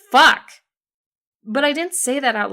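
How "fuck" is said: loud, higher blurted fuck; exasperated curse. A narrator's reading. The narrator's first thought on hearing Twilight is dying, a loud curse. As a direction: let it burst out.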